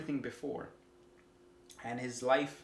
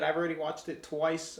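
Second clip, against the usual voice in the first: ordinary talk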